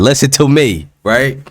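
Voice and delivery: normal talking voice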